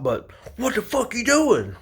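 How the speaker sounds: very high mock falsetto, imitating the boss; mocking boss impression. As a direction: mimic the boss's challenge way up high. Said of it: "Like that".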